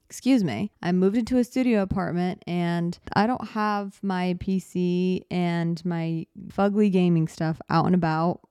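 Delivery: ordinary talk, nothing marked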